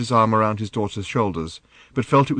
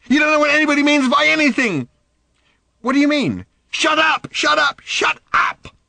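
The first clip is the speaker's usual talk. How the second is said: high, loud furious challenge; angry demand; 7 dB louder than usual. Acted. Daughter demanding angrily of her father. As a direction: spit the question at him. Random screaming at Arthur.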